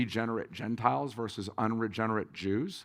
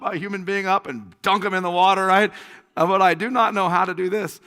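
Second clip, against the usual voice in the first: loud, high, frantic questioning; mock exasperation; 9 semitones higher, 12 dB louder than usual. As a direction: fire off questions in comic panic. Acts out his frantic questions.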